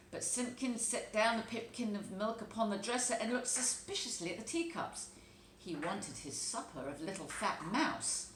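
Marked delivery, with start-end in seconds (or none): none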